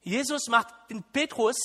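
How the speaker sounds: normal talking voice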